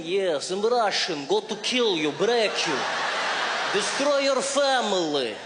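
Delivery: slow, heavy mock-Russian threat; menacing Russian accent. Comic mimics a menacing Russian accent. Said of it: "they've got that thing".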